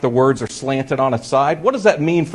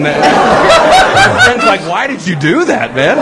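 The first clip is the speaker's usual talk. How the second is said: high, laughing disbelief; breaking up laughing; 3 semitones higher, 5 dB louder, slower than usual. Real, not acted. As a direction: laugh through the friend's question. Laughing retelling friend's reaction.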